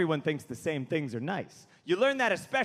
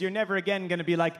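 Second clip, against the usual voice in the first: ordinary talk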